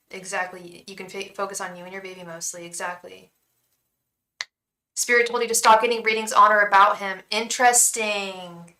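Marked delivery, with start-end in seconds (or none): none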